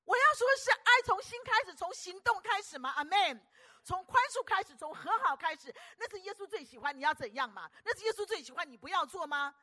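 high, loud, fervent preaching; rally the congregation with everything you have; loud, high sermon climax shouting amen; preaching at full force. Acted.

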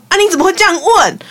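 high, quick mock-startled question; imitated surprised aunt. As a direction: act out an aunt caught off guard. Aunt reacts to awkward question.

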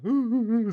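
high, loud mock whimpers; imitated whimpering. Imitating the classmate's crying, high and loud. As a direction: mimic a girl crying in short high whines. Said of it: "这样哭".

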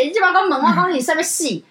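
ordinary delivery

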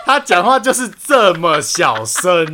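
talking through laughter, slow; laughing. Real, not acted. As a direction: speak while still laughing. Laughing after the skit. (边笑边说)

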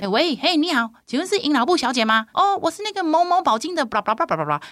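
mock high phone caller voice. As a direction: play the caller, high and chirpy.

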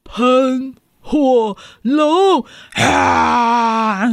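slow drawn-out wail; dramatic reveal. Pretend. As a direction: stretch it out for effect.

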